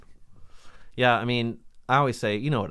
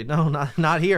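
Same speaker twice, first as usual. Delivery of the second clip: louder, higher mock scolding; firm refusal; 4 semitones higher, 7 dB louder, slower than usual. Telling her not in here, loud mock scolding. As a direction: raise your voice to shoo her off.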